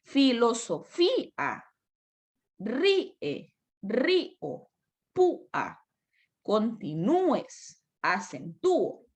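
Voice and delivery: "ríe" broken into RÍ, e; slow syllable demo from "Filosofía" to "acentúo"; hiatus. Shown as a demonstration. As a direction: pause after the stressed vowel, as in "púa" PÚ-a. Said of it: "no hiatos".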